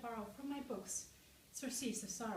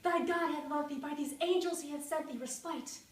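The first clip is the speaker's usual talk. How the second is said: loud, raised, impassioned declamation; crying out; 4 semitones higher, 8 dB louder than usual. A narrator's reading. Narrator berates himself about respite from grief, loud impassioned cry. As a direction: keep crying out, pitched high. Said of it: "I cried".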